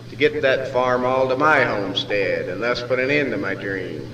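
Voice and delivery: ordinary delivery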